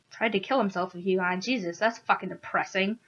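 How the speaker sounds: plain reading voice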